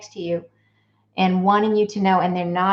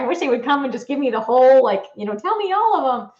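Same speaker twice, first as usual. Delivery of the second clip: eager wish; 5 semitones higher, 5 dB louder, faster than usual. Retold or imitated. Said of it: "'cause I was like".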